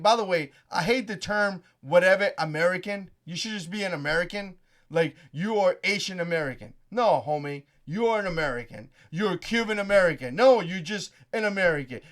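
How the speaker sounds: ordinary talk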